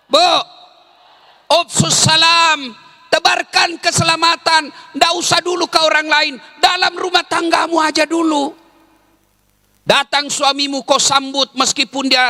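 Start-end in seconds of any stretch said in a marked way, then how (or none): none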